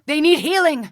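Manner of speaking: high, loud call for healing; urgent shout. Acted. Calling teammates to heal the wounded, urgent shout. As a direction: yell it across the battlefield.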